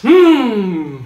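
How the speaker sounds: high, loud indulgent hum; self-absorbed hum. Shown as a demonstration. Self-centred actor hums on action, high and loud. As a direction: hum it all about yourself.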